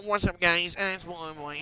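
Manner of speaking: loud staccato cartoon laugh around a greeting; laughing Blue Boy. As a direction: burst in with a mechanical ha-ha-ha. Blue Boy comes to life.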